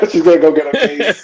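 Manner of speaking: laughing loudly mid-sentence; cracking up while speaking. Real, not acted. Teasing guest fetching her knives.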